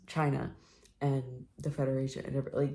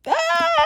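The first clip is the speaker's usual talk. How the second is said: high shriek; 9 dB louder than usual. Real, not acted. Shrieks at the book.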